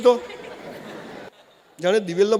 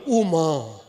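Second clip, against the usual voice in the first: mock groan; 4 semitones lower, 3 dB quieter, much slower than usual. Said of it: "છોકરો આમ પડે".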